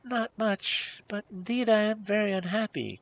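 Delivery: high, mildly tearful wail; tearful princess. A narrator's reading. Princess confessing to the hag, mildly tearful. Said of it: "bursting into tears".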